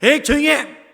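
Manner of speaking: loud, high-pitched rebuke; scolding crowd. Acted. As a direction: hush someone with an irritated yell. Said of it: "사람들이 꾸짖는 거죠". (큰 소리로 꾸짖듯)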